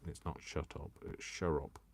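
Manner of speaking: ordinary talk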